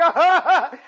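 high mock guffaw; imitated helper's laugh. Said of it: "his shamosh was like".

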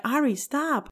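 retold annoyance at her husband; annoyed. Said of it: "getting really annoyed".

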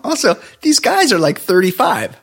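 high, incredulous, laughing protest; laughing disbelief. Real, not acted. Co-host mocking the bar guys' Vietnam grudge, laughing incredulously. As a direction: laugh through it, pitch jumping way up.

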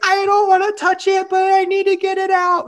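high, whiny imitation; whiny panic. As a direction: whimper it like a scared friend. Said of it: "And Alora's like".

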